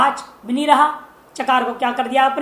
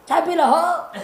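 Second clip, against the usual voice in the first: shouting; 5 semitones higher than usual. Retold or imitated. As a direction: call out the tea offer like a hearty yell. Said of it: "जोर से चिल्ला कर". (गाँव वाले चाय के लिए ज़ोर से चिल्लाते हुए)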